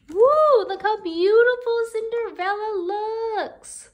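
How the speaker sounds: high, loud delighted exclamation; excited woo. Real, not acted. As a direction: gasp in delight at the picture. Teacher marvels at Cinderella.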